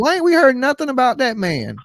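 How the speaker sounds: very high-pitched hammed-up impression; Nancy. Host demanding news of the absent father, in her best Nancy impression. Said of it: "here in my best Nancy voice".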